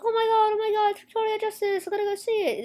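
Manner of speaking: high, put-on starstruck girl voice; excited little girl. Little girl begging to see Victoria Justice, mock excited. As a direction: imitate a little fan gushing up high. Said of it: "if I was a little girl".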